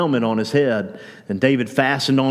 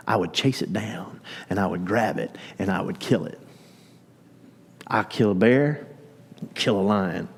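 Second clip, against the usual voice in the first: low intense vow; 4 semitones lower, 6 dB quieter than usual. Retold or imitated. David boasting of killing beasts.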